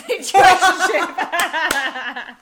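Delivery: bursting into laughter mid-sentence; loud laugh breaking through. Real, not acted.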